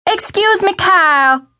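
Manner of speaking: high-pitched shriek. A narrator's reading. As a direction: shriek his name from the hall. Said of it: "a high-pitched voice shrieked from the hall".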